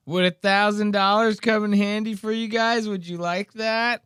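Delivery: high-pitched creepy come-on; mocking creep impression, pitched well up; Dave, creepy. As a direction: put on a high, creepy voice, mocking his offer. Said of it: "listen to how creepy Dave starts to sound".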